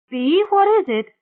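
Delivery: high, loud alarmed question; alarmed call. A narrator's reading. Mona calls to sobbing Bea, alarmed.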